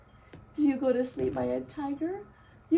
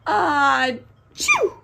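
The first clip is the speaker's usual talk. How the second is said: loud storybook sneeze; acted sneeze; 8 dB louder than usual. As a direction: build up and burst into a big sneeze. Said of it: "and he sneezes".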